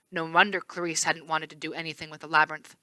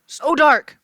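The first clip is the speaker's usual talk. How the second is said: higher, louder frightened yell; 8 semitones higher, 9 dB louder than usual. A narrator's reading. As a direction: yell it into the dark. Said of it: "Chris yelled".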